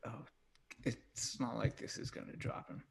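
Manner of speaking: soft unvoiced whisper. Real, not acted. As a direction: keep it hushed and secret. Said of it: "I love that you're whispering".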